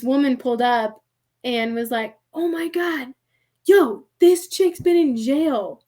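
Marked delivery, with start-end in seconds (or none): 2.3-5.8 high, drawn-out imitation of a stunned onlooker; slow, high-pitched retold shock; mock astonishment. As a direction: call out someone's scandal as a gawking bystander. Imitates onlooker who recognizes her.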